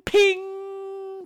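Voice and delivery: high, hammed-up mock grin voice; exaggerated fake-smile imitation; fake Ken doll smile. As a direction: sing out the ping like a sparkle on teeth. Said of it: "crack into this completely fake Ken doll smile".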